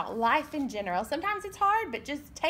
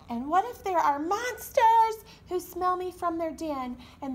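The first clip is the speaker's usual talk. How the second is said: put-on small high worried child voice; a scared little chick pleading; fearful little penguin; 5 semitones higher, 5 dB louder than usual. Little penguin fearing the water, high and anxious.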